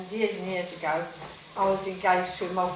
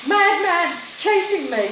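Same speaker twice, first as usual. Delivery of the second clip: high, loud cry for help; panicked shout; 8 semitones higher, 8 dB louder than usual. Retold or imitated. Fleeing woman yelling to the nun at the door, loud and high. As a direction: yell it to the nun as you run in.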